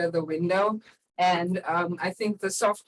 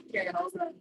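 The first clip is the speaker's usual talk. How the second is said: muffled mic; 10 semitones higher, 6 dB quieter, much faster than usual. Real, not acted. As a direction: voice higher and far softer, muffled. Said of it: "You kinda sound like a robot".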